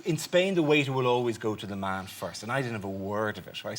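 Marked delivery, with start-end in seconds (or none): none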